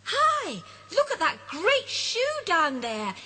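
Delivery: high, eager call pointing down; excited call. Acted. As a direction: interrupt with excitement. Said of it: "interrupts Rupert".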